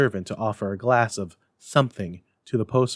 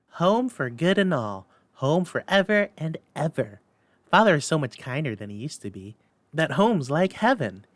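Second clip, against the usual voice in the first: put-on high, eager child's voice; little girl voice; 6 semitones higher than usual. A narrator's reading. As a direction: voice a delighted little sister. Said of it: "brimful of glee".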